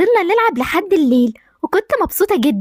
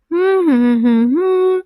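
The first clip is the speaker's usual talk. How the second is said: put-on girlish giggle; 2 semitones higher than usual. Girl laughs brushing teeth.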